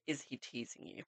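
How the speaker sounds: hushed whisper, breath only; whispered question. Real, not acted. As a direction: read the question in a whisper, as if telling a secret. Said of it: "I'll do the".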